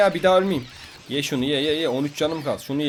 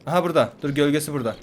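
ordinary talk

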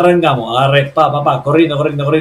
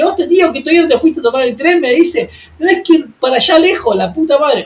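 very high, exasperated mock scolding; reto exasperado imitado; 11 semitones higher than usual. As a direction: act out frantic exasperation, pitched way up. Acted scolding, sent running again. (reto agudo y exasperado, actuado)

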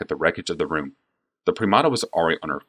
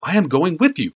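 pleading insistence; 10 semitones higher than usual. Acted. Joda insists on going along.

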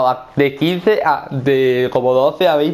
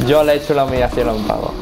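usual speaking voice

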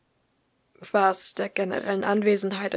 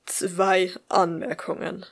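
slow, lightly grumbled remark; a hint of a grumpy boyfriend; mild grumble; slower than usual. A narrator's reading. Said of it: "brummte er".

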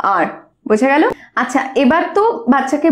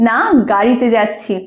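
ordinary talk, nothing marked

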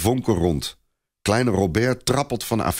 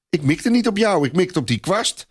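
put-on higher, cheeky child's voice; a boy answering the angry sergeant back; defiant boy; 11 semitones higher than usual. A narrator's reading.

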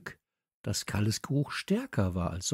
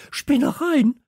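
very high, loud outraged screech; indignant squawk; shrieking; 9 dB louder, slower than usual. A narrator's reading. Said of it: "kreischte Kalle".

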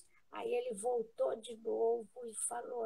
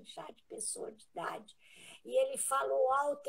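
ordinary talk

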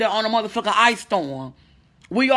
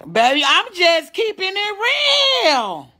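excited cry-out; 8 semitones higher, slower than usual. Real, not acted. Streamer shouting to viewers, drawn-out excited cry. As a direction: stretch it out in a happy cry. Crying out while reading chat.

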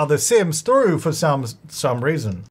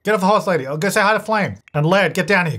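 normal talking voice

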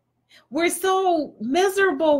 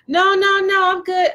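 higher, louder acted protest; insistent refusal; 6 semitones higher, 5 dB louder than usual. Partner fending off unwanted help, insistently refusing. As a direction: hurriedly fend them off, pitched up.

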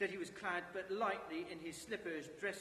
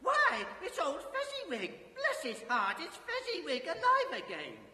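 much higher, louder joyful cry; great excitement; 9 semitones higher, 8 dB louder than usual. Acted. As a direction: cry out, laughing with delight. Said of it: "cried out in great excitement".

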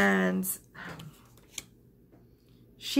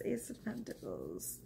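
"Ace of Pentacles" repeated much softer, almost breathed; near-whispered repeat; 2 semitones higher, 14 dB quieter than usual. Real, not acted. Tarot reader softly repeating the card name to viewers, hushed. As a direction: drop to a hush on "Ace of Pentacles".

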